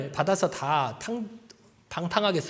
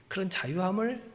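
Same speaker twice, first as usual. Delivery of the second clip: confiding whisper; 4 semitones lower, 12 dB quieter than usual. Real, not acted. Confiding aside.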